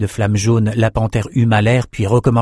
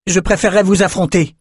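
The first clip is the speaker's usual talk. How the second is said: higher-pitched, raised-voice challenge; defiant cry; 10 semitones higher, 3 dB louder than usual. A narrator's reading. Young swordsman challenging the Mauracite, crying out. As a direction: call out a challenge from below. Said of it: "cria-t-il à la Mauracite".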